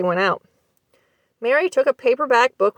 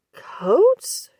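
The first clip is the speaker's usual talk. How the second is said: quivered; 8 semitones higher than usual. A narrator's reading. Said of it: "she quivered".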